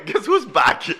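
loud, high showman entrance; loud mock-grand comeback call; hyped entrance. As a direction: announce yourself like a star returning. Cohost's grand entrance line.

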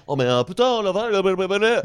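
put-on light France French accent, the là's jumping up; imitating a friend's accent. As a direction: mimic a Frenchman rambling là là là. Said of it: "il tripait sur mon accent". (imitation d'un accent français de France)

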